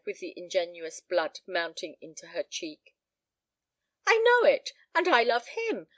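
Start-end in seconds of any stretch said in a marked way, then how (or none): 4.1-5.9 high, loud burst easing slightly; impassioned cry. A narrator's reading. Daughter declaring her love to her father, impassioned cry. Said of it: "cried Helen Clive".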